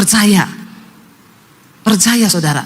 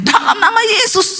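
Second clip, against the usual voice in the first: acted cry; 13 semitones higher than usual. Husband finally manages the holy name, high-pitched cry. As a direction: let the name burst out high. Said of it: "suaminya teriak".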